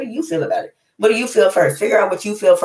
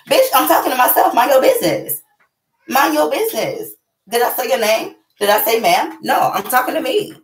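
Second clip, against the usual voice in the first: sassy comeback; 8 semitones higher than usual. Pretend. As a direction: put the nosy neighbor in their place. Snapping back at nosy stranger.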